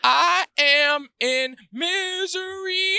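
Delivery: high, slow cracking voice. Real, not acted. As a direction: voice breaks up high on misery. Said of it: "Oh God, my voice cracked".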